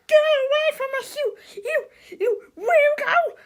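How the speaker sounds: very high, loud panicked shriek; shrieking yell. Acted. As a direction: drive off the weirdo with your handbag. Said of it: "Miss Sneedley yelled".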